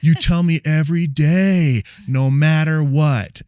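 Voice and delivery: put-on loud, high, slow child's voice; singsong child's no matter what, loud and slow; four-year-old daughter. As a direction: belt it out like a proud little kid. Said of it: "And she goes".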